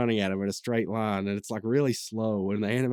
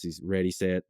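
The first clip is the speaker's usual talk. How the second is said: ordinary talk, nothing marked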